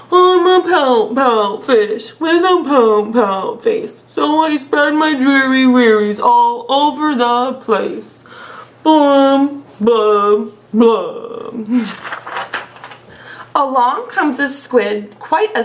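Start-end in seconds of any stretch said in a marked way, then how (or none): 0.1-11.9 slow, drawn-out mopey fish voice; glum pout fish voice. Acted. Teacher reads the sulky fish refrain, slow and mopey. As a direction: drag each word out like a gloomy sulking fish. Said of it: "I love doing the silly voices".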